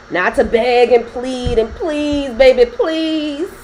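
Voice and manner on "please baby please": higher and slow, pleading to be taken back; hammed-up whiny begging; begging an ex to come back, a mock plea; mock begging lover.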